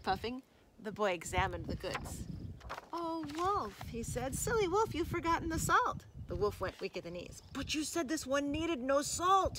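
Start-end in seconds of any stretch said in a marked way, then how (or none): 2.9-3.8 high, sighing reproach; mock pity. Boy greeting the puffing wolf, high mock pity. 4.4-6.0 high singsong chiding; teasing reproach. Acted. Boy scolding the struggling wolf, high teasing reproach. 7.5-9.6 higher, wailing complaint; groaned protest. A narrator's reading. Weary wolf objecting to the boy, groaning. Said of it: "he groaned".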